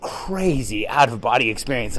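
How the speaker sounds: normal talking voice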